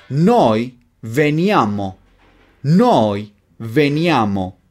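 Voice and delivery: slow, each word set apart; slow modelling. Shown as a demonstration. Teacher gives learners the conjugation to repeat, slowly.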